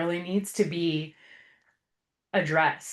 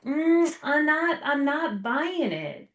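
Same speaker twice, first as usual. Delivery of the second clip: slow, higher-pitched doubtful hedge; skeptical inkling; 8 semitones higher, slower than usual. Acted. Host voicing her doubt that the economy is great, skeptical. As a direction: draw it out high, unconvinced. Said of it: "I have this little inkling like".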